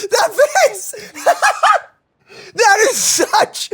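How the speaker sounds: laughing. Real, not acted. Laughs at the character's face.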